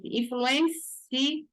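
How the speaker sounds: "Influencia" drawn out very slowly, opening the final "cia"; slow sound demo. Shown as a demonstration. Teacher showing students the rising diphthong, slow. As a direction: stretch "Influencia" so the ending opens up.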